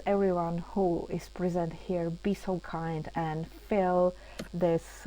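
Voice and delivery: usual speaking voice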